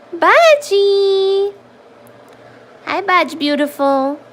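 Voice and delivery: high, loud call of the cat's name; high excited call to the cat. Real, not acted. Calling the cat.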